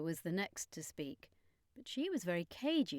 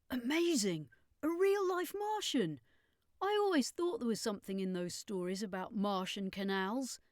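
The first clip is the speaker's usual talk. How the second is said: excited wonder; 4 semitones higher than usual. A narrator's reading. Boy meets a real Martian.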